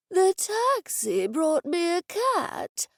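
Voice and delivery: put-on slow, shaky old man voice; the old office owner welcomes Edison, slow and quavering; elderly man. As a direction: speak like a frail old gentleman. Old Mister Dennison mishears.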